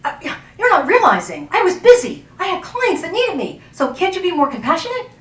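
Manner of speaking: hammed-up indignant comeback; mock defensive protest. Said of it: "I'm not sitting there going".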